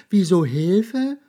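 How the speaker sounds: high, loud, slow squeaky question; mouse asking back. A narrator's reading. Mouse throws the question back at the monster, high and loud.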